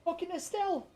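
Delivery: high panicked shriek. Real, not acted. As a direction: squeal the name in panic. Boss appears, panic.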